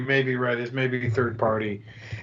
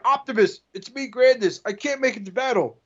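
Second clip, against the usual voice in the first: goofy robot voice; 7 semitones higher than usual. Pretend. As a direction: report a failure, dopey and cheerful. Robot radios report from beach.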